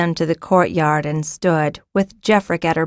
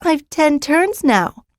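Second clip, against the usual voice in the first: put-on high, slow proud child; girl boasting to a younger boy; proudly; 9 semitones higher, slower than usual. A narrator's reading. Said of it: "told him proudly".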